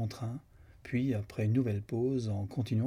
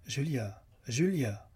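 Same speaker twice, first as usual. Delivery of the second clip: calling her name out, harder the second time; sharp, nearly harsh call to wake her; loud, hard call; 3 semitones higher, slower than usual. A narrator's reading. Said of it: "d'une voix haute et presque dure".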